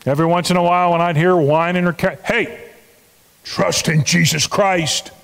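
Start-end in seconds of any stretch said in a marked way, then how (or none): 2.2-5.1 slow, firm little reprimand; mock admonishing. Pastor reminding the sick woman to trust, mock admonishing. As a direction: chide her gently but pointedly. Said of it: "That's admonishing".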